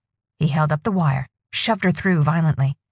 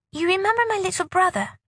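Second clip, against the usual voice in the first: put-on young girl voice; 12 semitones higher than usual. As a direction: speak as a frightened young girl.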